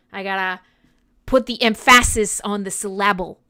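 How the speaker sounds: ordinary talk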